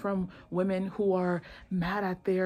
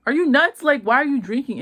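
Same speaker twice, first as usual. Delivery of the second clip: higher, louder mock outburst; retold incredulous scolding; 7 semitones higher, 7 dB louder than usual. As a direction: act out alarmed disbelief at her. Acting out the man's shocked scolding.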